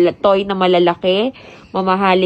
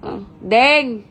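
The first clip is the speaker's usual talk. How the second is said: higher-pitched call to someone; calling out sharply; 6 semitones higher than usual. Real, not acted. Parent checking what Deng is doing, calling out. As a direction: call over to find out what they're doing.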